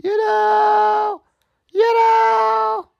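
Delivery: high shouted you know; shouted falsetto. Real, not acted.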